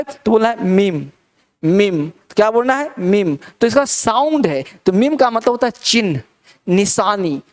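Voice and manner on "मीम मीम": "मीम" as one syllable, long ee, closing m; correct pronunciation. Shown as a demonstration. Teacher shows students how to say meme, "मीम" as one syllable. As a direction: say "मीम" like "meem", falling. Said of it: "क्या बोलना है भाई".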